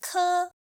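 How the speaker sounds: "Ke" held high and level; first tone. Shown as a demonstration. Teacher reading four tones, "Ke" high and flat. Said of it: "read it with four different tones".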